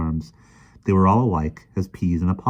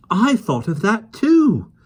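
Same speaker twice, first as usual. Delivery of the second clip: put-on high, slow girl voice; a girl cutting in with her idea; eager girl; 6 dB louder, slower than usual. Jane speaks.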